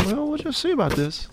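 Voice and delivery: high, quiet sneering villain; hammed-up sinister gloat; mock villain voice. Mock villain retort to teasing.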